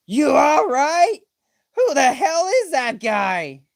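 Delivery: high, slow wailing cry; panicked Usopp. Acted. Usopp freaking out at the enemy, teary high wail. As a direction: whimper it in terror.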